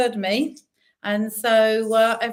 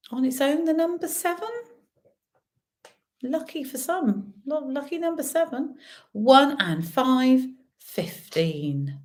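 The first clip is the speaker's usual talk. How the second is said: bingo caller; 3 semitones higher than usual. Acted. Bingo calling numbers.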